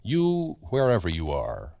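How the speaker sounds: usual speaking voice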